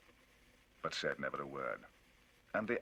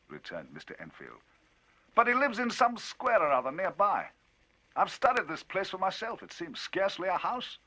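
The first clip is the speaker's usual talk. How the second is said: higher, louder, lively telling; Enfield, indignant storyteller; 13 semitones higher, 9 dB louder than usual. Acted. Enfield telling Utterson of the cheque, indignant and loud. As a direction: drive the tale on, indignant.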